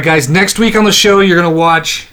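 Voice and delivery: ordinary talk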